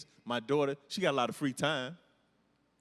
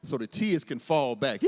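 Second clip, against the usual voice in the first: ordinary talk, nothing marked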